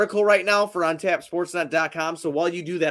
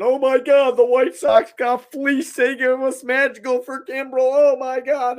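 high, louder fan outcry; mock Cubs fan; 7 semitones higher, 4 dB louder, slower than usual. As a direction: imitate an overexcited fan wailing about a trade. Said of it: "with that Cubs fan going".

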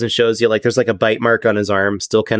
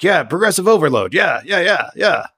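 higher sing-song gym chatter in the background; chatty guard, pitched up and repetitive; jabbering guard; 6 semitones higher than usual. Acted. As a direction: jabber on obliviously with bouncing "yeah"s. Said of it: "still jibber jabbering".

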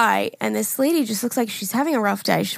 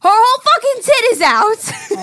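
put-on loud, slow, higher Staten Island accent, shocked; 12 semitones higher, 7 dB louder, slower than usual. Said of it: "so I'm gonna put on an accent".

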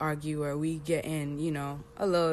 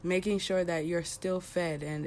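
normal talking voice

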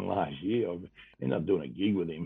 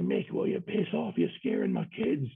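high mock shout from the stands; retold crowd yell; 9 semitones higher than usual. Fan in the crowd yelling at Mick, high mock shout. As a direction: call out at Mick like a cheeky fan. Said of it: "some bloke in the crowd's yelled out".

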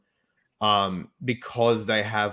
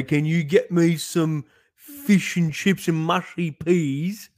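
slow, higher hammed-up British accent; mock British accent; 8 semitones higher, 5 dB louder, much slower than usual. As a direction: drag it out like a cartoon Brit. Joking British food request.

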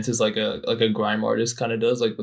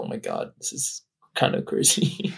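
hushed aside; 10 semitones lower, 7 dB quieter than usual. Retold or imitated. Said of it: "and I was like".

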